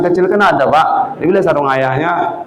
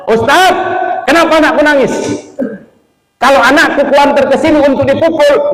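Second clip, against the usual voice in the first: acted angry mother, high-pitched; mother scolding teacher; 9 semitones higher than usual. Mother confronting teacher over her crying child, acted high-pitched scolding. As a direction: protest indignantly in a raised, high voice. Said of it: "Marahin Ustaz".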